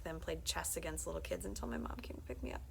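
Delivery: usual speaking voice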